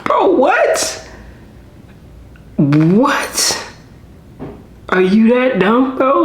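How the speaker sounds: high-pitched, squeaky disbelief; incredulous. Real, not acted. Reactor to artist who signed anyway, high and incredulous. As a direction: voice shoots up, baffled.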